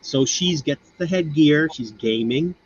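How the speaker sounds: ordinary talk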